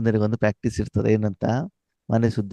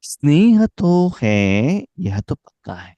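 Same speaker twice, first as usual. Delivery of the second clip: slow, higher, louder quoted line; slow raised voice quoting the teacher; quoting Baba; 5 semitones higher, 4 dB louder than usual. Acted. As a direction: lift the quote up and stretch it out. Hindi quote of Baba, far voice.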